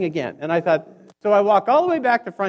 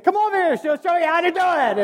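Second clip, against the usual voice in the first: mock helpful, laughing; 7 semitones higher than usual. Sending guy to clerk.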